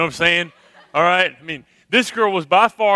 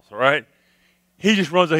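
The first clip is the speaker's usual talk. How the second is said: ordinary delivery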